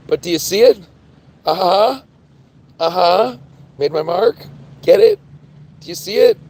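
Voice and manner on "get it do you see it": squeaky high teasing. Pretend. Streamer teasing chat about her joke in a squeak.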